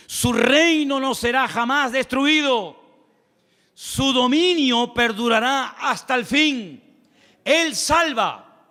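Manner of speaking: slow, high, ringing sermon proclamation; fervent preaching. Acted. Preacher proclaims God's reign to the congregation, fervent and slow. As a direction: declaim each phrase like a pulpit climax. Preaching scripture at full force.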